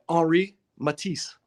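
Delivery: name said with a French accent, silent H in Henri. Shown as a demonstration. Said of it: "That was my French accent".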